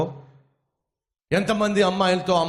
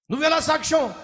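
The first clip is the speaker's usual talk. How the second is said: scornful challenge; 12 semitones higher, faster than usual. Acted. Thug challenges.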